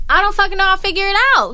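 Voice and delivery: high, loud mock yell; retold shout at mom over the lost car; exasperated yell. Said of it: "And I was like".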